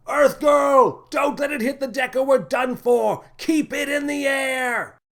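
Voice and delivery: captain yelling at Lily to save the ship; frantic shout. A narrator's reading. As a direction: bellow orders in a crisis. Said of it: "the captain shouted".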